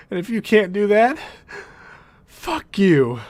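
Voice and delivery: mock-furious friend cursing out Barry; angry Cisco outburst. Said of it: "Cisco's argument is".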